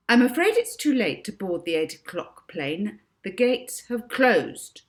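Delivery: a touch of smug clerk; firm clerk. A narrator's reading. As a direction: shut him down. Clerk refuses professor firmly.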